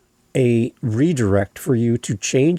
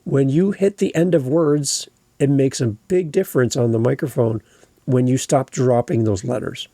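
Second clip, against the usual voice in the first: normal talking voice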